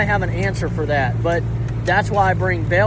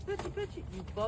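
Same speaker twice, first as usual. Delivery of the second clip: holding down a pet; 9 dB quieter, faster than usual. Real, not acted. Coaxing the dog to come.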